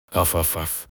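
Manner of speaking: soft little puppy barks. Acted. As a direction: bark it like a small puppy. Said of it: "залаял щенок".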